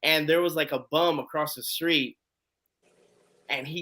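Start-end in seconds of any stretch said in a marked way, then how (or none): none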